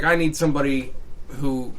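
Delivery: usual speaking voice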